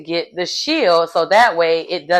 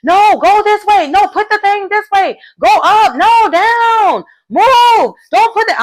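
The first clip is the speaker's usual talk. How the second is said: much higher, louder chaotic yelled orders; imitated screaming; 13 semitones higher, 6 dB louder than usual. Teammates yell conflicting directions at the swimmers, imitated screaming. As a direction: shout over each other in a panic. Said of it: "everybody is screaming".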